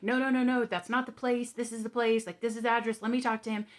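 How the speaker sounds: high, loud, hurried insistence; rapid anxious protest. Retold or imitated. Family member panicking about the address, loud and rushed. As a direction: cut in fast and loud, correcting the address. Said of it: "started talking very quickly and was like".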